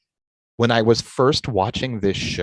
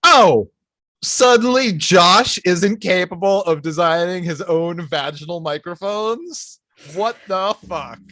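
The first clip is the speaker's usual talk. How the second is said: mock outrage; 9 semitones higher, 7 dB louder than usual. Mock offended outburst.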